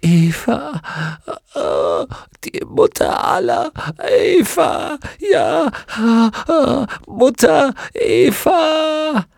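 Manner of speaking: very high, gasping cries; broken wail with drawn-out words; agonised confession. Acted. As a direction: cry the name out in torment. Witch gives up name in agony.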